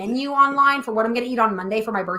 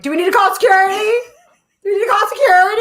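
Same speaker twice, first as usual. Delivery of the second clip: higher, louder teasing; teasing mock question; 9 semitones higher, 4 dB louder, much faster than usual. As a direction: tease her about the bathroom. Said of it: "they were like".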